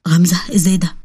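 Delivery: weak, breathless whisper. Acted. Injured Saleem whispering to Ghamza in shock. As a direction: barely get the words out.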